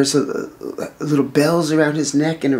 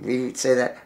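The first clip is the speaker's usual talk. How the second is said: usual speaking voice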